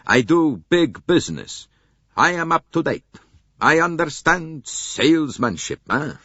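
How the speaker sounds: put-on loud, boastful salesman with a light Italian accent; slight Italian accent. A narrator's reading. Italian car salesman boasting to the detectives, loud Italian accent. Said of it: "with only a slight accent".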